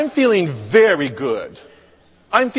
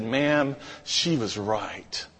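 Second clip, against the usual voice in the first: low, soft, slow admission; calm it right down, quietly conceding; hushed, chastened answer; humbled reply; 6 semitones lower, 7 dB quieter, slower than usual. Retold or imitated. Humbled reply to ranting cashier.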